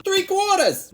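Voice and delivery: high, loud call; calling out measures. Pretend. Calls out measures while pouring.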